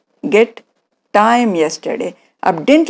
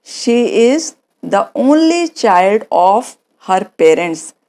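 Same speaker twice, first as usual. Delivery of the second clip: slow model answer; much slower than usual. Shown as a demonstration. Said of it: "तो आप क्या बोलोगे".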